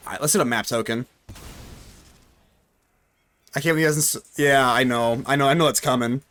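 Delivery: normal talking voice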